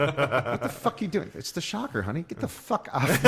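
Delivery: high, loud wife's protest, played for laughs; mock outraged wife. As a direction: act out an appalled wife telling him off. Wife's outrage acted in stand-up bit.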